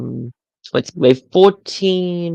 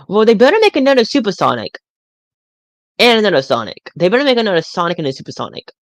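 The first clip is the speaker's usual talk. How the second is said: excited demand; 6 semitones higher, 3 dB louder, faster than usual. Real, not acted. Demanding new toys from the company.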